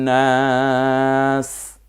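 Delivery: long-held নাস ending on a hissed s; stopped final s. Shown as a demonstration. Teacher showing learners how to stop, long-held নাস with a final s. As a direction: draw out নাস and stop on a bare s. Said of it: "থামলে সিটাকে আমরা শুধু স বলবো".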